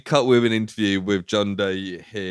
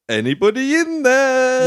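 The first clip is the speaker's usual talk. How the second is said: calling out; 13 semitones higher, 4 dB louder than usual. Retold or imitated. Film woman calling down into the basement, high mock call. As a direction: holler into the dark, imitating her.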